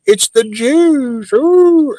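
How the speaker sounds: high, slow, sneering put-on voice; mock conspiracy voice. Mocking conspiracy talk.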